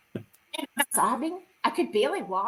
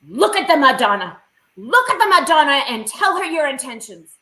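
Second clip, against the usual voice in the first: loud, firm instruction from a bath attendant; stern attendant; 2 semitones higher, 9 dB louder than usual. Retold or imitated. Attendant orders the visitor to face the Madonna, loud and firm. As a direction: command it straight into her ear. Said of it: "this woman comes up to me".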